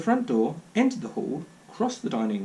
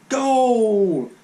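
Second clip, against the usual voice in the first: loud, high commentator cry of goal; crowd-roar goal call from the telly; TV goal shout; 7 semitones higher, 8 dB louder than usual. Acted. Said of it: "said the television set".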